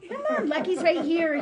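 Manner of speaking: high coaxing call to a dog. Real, not acted. Calling Lucky closer for the viewers, in coaxing pet talk. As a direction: coax him over, sing-song.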